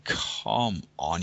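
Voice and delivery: normal talking voice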